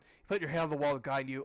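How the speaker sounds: ordinary delivery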